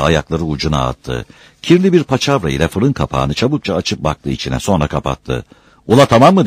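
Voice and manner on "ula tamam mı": high, loud call to the workers; shouted question. A narrator's reading. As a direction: holler it out, rising at the end. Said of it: "diye bağırdı". (yüksek sesle bağırarak)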